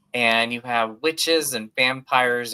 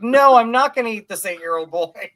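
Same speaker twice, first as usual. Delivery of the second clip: higher and louder, hammed-up protest; a playful put-upon teen refusing; mock indignant zombie; 9 semitones higher, 7 dB louder than usual. Zombie son refuses snack child.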